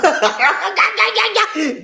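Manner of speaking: high, rapid cackling laugh; long high cackle. Real, not acted. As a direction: explode into high-pitched laughter. Laughs after shouting catchphrase.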